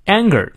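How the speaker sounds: "Anger" hit firmly on the first syllable; stress on ANGER. Shown as a demonstration. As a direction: lean on "Anger" as the stressed word. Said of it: "Anger重读".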